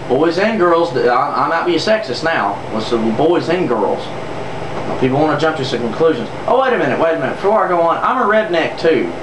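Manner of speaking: ordinary talk, nothing marked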